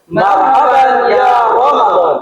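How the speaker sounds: high, slow, sung-out greeting; drawn-out chanted salam to open the show. Acted. (salam pembuka acara, tinggi dan lambat seperti dilantunkan)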